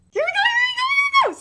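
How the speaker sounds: very high-pitched giddy squeal; excited squeal. Real, not acted. Reviewer sees the awaited scene starting, excited squeal. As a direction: squeal it in real anticipation.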